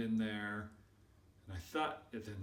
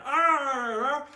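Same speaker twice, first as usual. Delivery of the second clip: loud, high growling roar; hammed-up devouring growl; hyena snarl; 14 dB louder than usual. Shown as a demonstration. Storyteller shows kids how to attack food, hyena roar.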